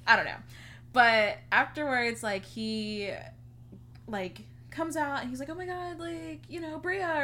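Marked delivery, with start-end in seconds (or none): 5.5-7.2 high, breathy-sounding gush of a greeting, much higher. Retold or imitated. Gemini greeting her after his set, a high mock gush. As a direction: play him gushing hello, high up.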